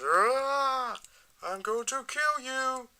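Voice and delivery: high, drawn-out mock roar; mock enraged. Acting out the figure when really annoyed, mock rage. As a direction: draw out a hammed-up threat, pitched high. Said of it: "when he goes really annoyed and goes".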